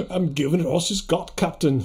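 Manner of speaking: put-on Edinburgh Scottish accent; playful Edinburgh burr; Scotty. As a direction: do a Scottish starship engineer. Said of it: "pretty good for Edinburgh".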